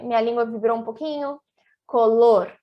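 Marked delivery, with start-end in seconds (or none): 1.9-2.5 "Color" with one tongue tap, said louder; loud teacher demo of "Color"; Spanish tapped R. Shown as a demonstration. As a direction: tap the final R of "Color" once, clearly. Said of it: "fiz aqui um sonzinho do vibrante".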